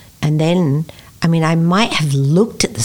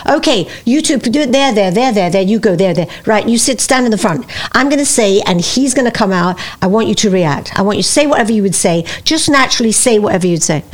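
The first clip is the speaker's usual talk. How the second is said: brisk, raised director's voice; director giving orders; 5 semitones higher than usual. Retold or imitated. Director placing young actors on camera, brisk orders. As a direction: rattle out stage directions to the cast. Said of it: "he'd say".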